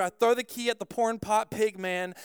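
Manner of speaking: ordinary talk, nothing marked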